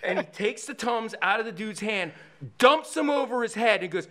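ordinary talk, nothing marked

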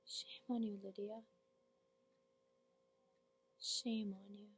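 soft, drained reproach; monologue character, wounded. Acted. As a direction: let the accusation sink to near silence.

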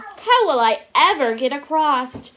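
storybook dismay; sad bee. A narrator's reading. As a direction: lament it with a big swoop on EVER. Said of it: "sadly flopping down on the grass".

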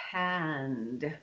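"hand" stretched slowly from a long h; careful articulation. Shown as a demonstration. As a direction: draw out every sound in "hand". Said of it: "learn to articulate something like".

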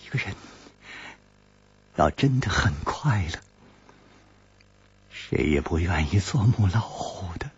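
fading into a whisper; sigh, then let the words sink to a whisper; wistful woman trailing off to a whisper; soft sigh. A narrator's reading. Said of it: "悠悠地说".

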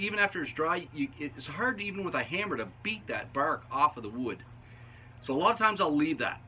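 ordinary talk

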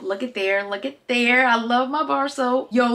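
normal talking voice

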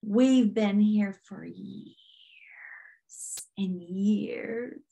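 very slow, creaking drawl; old squeaky-door demon. Retold or imitated. Demon telling her how long it has been there, slow creaky plea. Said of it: "an old squeaky door".

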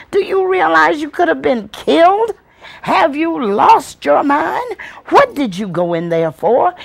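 high-pitched, alarmed scolding in rapid questions; aunt screaming and yelling. Acted. Aunt to child who ran into the house, yelling scolding. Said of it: "She was screaming and yelling".

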